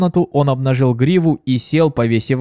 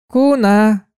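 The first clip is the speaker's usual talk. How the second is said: calling out; 10 semitones higher than usual. Acted. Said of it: "крикнула Пегасочка".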